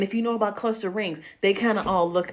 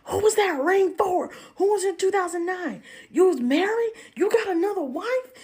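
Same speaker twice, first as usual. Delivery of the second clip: imitating furious wife; 11 semitones higher than usual. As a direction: fire the questions like a betrayed wife. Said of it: "all I hear is".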